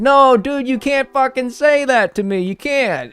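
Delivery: high, loud indignant protest; hammed-up indignant objection; mock outrage. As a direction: squawk in disbelief, voice shooting up. Mock outrage at a bad opinion.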